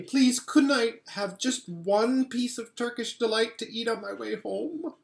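put-on pleading boy. A narrator's reading. Edmund begging the Queen for Turkish delight, pleading.

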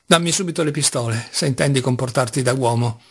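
ordinary delivery

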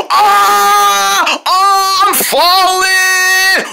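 high, loud scream of falling. Pretend. Kid pretends to fall from the sky, screaming. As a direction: yell as you plunge through the air.

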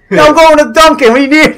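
high, hammed-up Boston accent; mock-Boston heckle, laughing; Massachusetts accent. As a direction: call out like a broad Boston local. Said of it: "we're from Mass".

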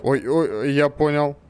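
ordinary delivery

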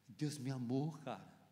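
low, soft confession; quiet awe. Real, not acted. Preacher recalling being loved while a wreck, quiet awe. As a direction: let it drop low, almost to yourself. Moved testimony, before shouting it.

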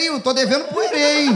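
a believer suddenly remembering a debt; mock worried macumbeiro. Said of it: "No caso do macumbeiro". (macumbeiro preocupado, voz mais aguda)

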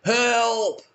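high desperate cries of help; hanging off a ledge, calling up high; yelling for help. Retold or imitated. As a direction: cry out for rescue from the cliff. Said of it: "he yelled".

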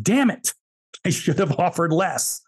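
sudden high, exasperated outburst that trails off slowly; frustrated self-scolding. Retold or imitated. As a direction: kick yourself for leaving money on the table. Retold kicking himself after deal.

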